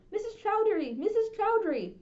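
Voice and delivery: high, loud frantic calls; shouting a name. Acted. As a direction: call out her name with all your breath. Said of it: "I shouted for Missus Chowdhury".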